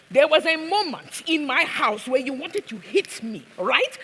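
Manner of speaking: high, heated accusation; acted outburst, pitched high; yelling. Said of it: "stop yelling".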